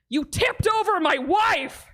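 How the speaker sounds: slow, high outraged shout; slow, high mock-outraged yell at the groom.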